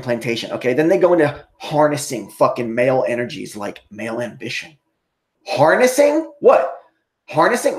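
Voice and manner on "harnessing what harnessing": higher, louder outraged questioning; outraged disbelief. Real, not acted. Vlogger repeating PragerU's word back, outraged disbelief.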